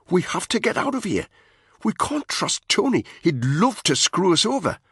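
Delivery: worried soldier urging his friend; Spud, urgent. A narrator's reading. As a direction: push him to see sense, pressing. Spud pleading.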